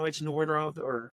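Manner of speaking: ordinary talk, nothing marked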